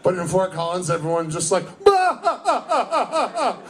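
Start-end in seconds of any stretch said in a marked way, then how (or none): 1.8-3.6 high, haughty aristocratic guffaw; snooty mock laugh. Comic playing posh Fort Collins crowd, snooty laugh. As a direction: laugh like a rich snob at peasants.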